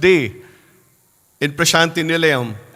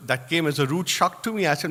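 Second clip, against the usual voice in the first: usual speaking voice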